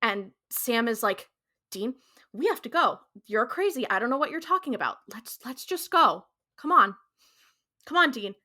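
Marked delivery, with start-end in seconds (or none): 1.7-8.3 higher, urgent retold plea; imitated begging. Sam begs Dean to leave, urgent retold pleading. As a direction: plead insistently, pushing harder on each urging. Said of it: "practically begging".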